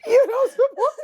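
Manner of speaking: high laughing squeal. Retold or imitated. Repeating the DM's accusation, high laughing squeal. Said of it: "It would be nobody and then".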